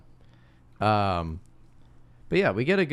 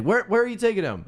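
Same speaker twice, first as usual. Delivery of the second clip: high, louder alarmed question; alarmed woman; 13 semitones higher, 5 dB louder than usual. Retold or imitated. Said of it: "it's like".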